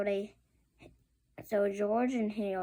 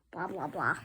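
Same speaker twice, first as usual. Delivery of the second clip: grumpy principal; 5 semitones lower than usual. Acted. Mean principal grumbles.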